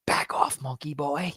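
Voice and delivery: breathy hushed threat in a put-on voice; hushed imitation of a mock-tough line; hushed menace. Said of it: "he's like".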